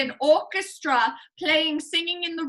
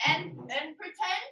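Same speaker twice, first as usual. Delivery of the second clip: usual speaking voice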